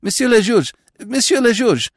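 high, flustered, stammering. Acted. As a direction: blurt it out breathlessly. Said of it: "stammered the attendant".

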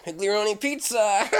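high goofy pizza delivery guy. Acted. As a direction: bounce it like a cartoon pizza guy. Asked about playing pizza guy.

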